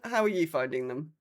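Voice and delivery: normal talking voice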